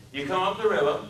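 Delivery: Deep South man making chat; deep Southern accent. Acted. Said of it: "His speech was the deepest South".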